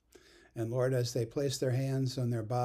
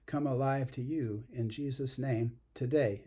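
ordinary delivery

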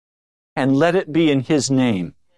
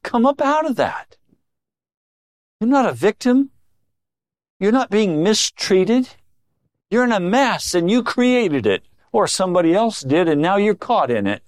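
God's rebuke; 6 semitones higher than usual. Retold or imitated. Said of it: "He's saying".

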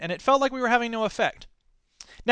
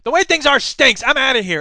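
loud, high, outraged outburst; defiant duck; 6 semitones higher, 7 dB louder than usual. Retold or imitated. Duck in Babe rejecting the cow's advice, defiant. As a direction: burst out in protest and storm off. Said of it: "And the duck says".

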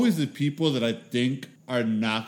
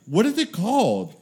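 lightly imitated old ladies, higher pitch; curious older ladies; 6 semitones higher than usual. Elderly friends asking about the podcast, curious. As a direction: ask it high and innocently.

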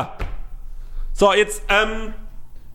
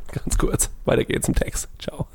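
whispered aside; 6 semitones lower, 7 dB quieter than usual. Acted. Flüstert Zwischenbemerkung.

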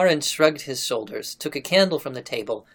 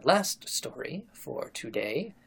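slow, softening storyteller; hushed and solemn; 7 dB quieter, slower than usual. Real, not acted. Host sets up the day's last story, hushed. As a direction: let it sink slowly into quiet.

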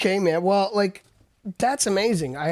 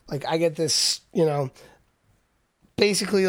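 ordinary talk, nothing marked

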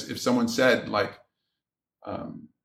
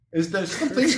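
lightly imitated therapist voice, pitched up; Doctor Ruth; 5 semitones higher than usual. Shown as a demonstration. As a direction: put on a higher radio sex-therapist voice. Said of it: "ask this in the voice of Doctor Ruth".

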